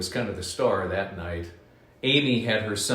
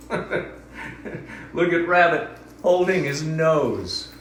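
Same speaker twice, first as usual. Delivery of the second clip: laughing; 6 semitones higher, much slower than usual. Real, not acted. Laughing at picture.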